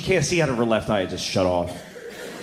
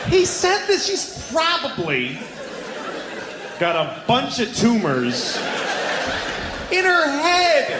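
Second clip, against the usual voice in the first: high, slow, drawn-out disbelief; incredulous outrage; 11 semitones higher, slower than usual. Real, not acted. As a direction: spell it out, appalled, word by word. Incredulous repeat of doctor's words.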